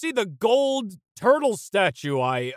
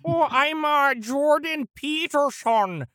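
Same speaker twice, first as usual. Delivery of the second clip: Jordan Peterson voice; 5 semitones higher than usual. Retold or imitated. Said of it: "how's that?".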